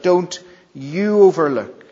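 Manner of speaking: preacher repeating the emphatic you; stress on YOU. Shown as a demonstration. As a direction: drive home the word you. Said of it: "it's emphatic in the original".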